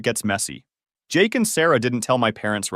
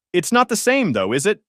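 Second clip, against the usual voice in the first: higher, pointed passive-aggressive question; passive-aggressive dig, pitched up; 9 semitones higher, 3 dB louder than usual. A narrator's reading. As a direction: needle them like a disapproving mom. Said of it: "making passive-aggressive comments about adoption".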